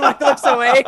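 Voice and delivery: ordinary delivery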